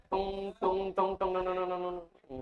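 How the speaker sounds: monotone ringing beeps, trailing off; ECG beep imitation. Shown as a demonstration. Teacher hinting at ECG to class, imitated monitor beeps. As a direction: imitate a heart monitor running into a flatline, fading.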